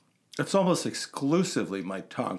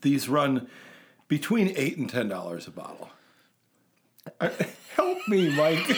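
ordinary delivery

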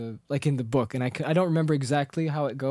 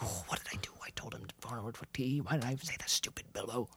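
a much softer, whispered "stupid Bilbo"; awkward whispered self-scolding; 4 semitones lower, 13 dB quieter than usual. Retold or imitated. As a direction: hiss it at yourself in regret. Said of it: "feeling really awkward".

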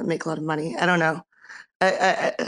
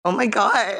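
giggling through the words; laughing; 5 semitones higher, slower than usual. Real, not acted. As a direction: laugh it out, delighted. Laughing at fan's compliment.

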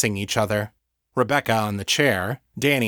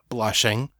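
ordinary delivery

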